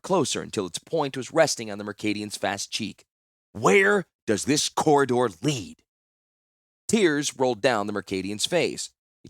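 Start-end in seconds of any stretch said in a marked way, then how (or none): 3.5-5.8 slow, loud, threatening question; slow menacing demand. Acted. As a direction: lean in and threaten, word by word. Dart held to captive's cheek.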